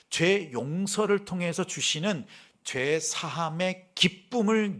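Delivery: full-voiced pulpit preaching. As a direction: drive the point home to the congregation. Preaching to the congregation.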